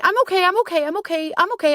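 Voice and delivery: high chirpy insistence; mock brave face. Woman mocking how she hid her needs, high chirpy. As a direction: chirp it out, hiding the hurt. Mock self-minimizing reassurance.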